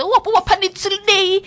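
high, loud whiny quote; mock complaint. Host mimicking a girl who claims she panics. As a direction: whine it like a fussy girl. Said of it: "E one say".

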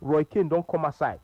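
ordinary talk

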